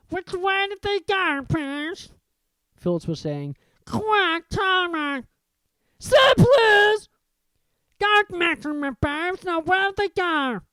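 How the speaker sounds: lightly voiced old caretaker, urgent; grumpy caretaker. A narrator's reading. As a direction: hiss at the poltergeist, desperate. Filch hunting the students.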